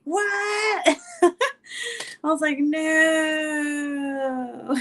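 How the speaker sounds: high, laughing disbelief; shocked no. Retold or imitated. Vlogger reliving dad watching her live, shocked laughing. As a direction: squeal it out half laughing.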